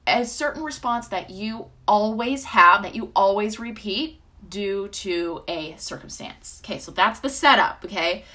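usual speaking voice

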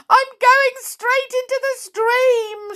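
very high frightened squeal. A narrator's reading. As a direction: squeal in panic as the water rushes up. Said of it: "she squealed".

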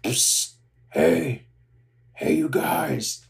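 lightly voiced big Muppet, slow furtive call; a hint of a grouchy monster, drawn-out hail; Sweetums. Storyteller as Muppet calling two friends from a door, lightly imitated character voice. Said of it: "Sweetums pokes his head out of the door".

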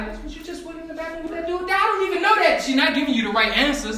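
high loud animated telling; excited retelling. Retold or imitated. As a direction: act the scene out loud. Acting out party scene.

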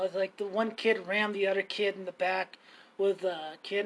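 ordinary talk, nothing marked

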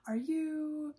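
TV commercial announcer; infomercial voice. Pretend. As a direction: pitch it like a TV ad. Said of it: "in that infomercial, commercial-y voice".

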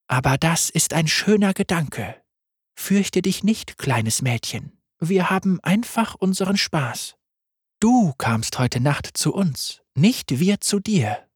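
deutlich höher, sanft und verspielt; helle Lamm-Stimme. Acted. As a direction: ein unheimlich freundliches Lamm. Lam voice again. (Lamm stellt sich vor, hoch und sanft)

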